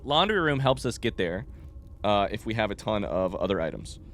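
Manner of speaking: normal talking voice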